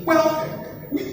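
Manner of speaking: usual speaking voice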